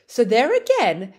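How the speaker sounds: ordinary delivery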